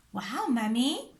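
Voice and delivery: plain reading voice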